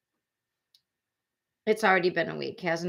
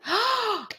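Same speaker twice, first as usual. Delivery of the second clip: high sharp intake of breath; a real gasp of shock. Real, not acted. Streamer reads of a knee injury, real sharp gasp.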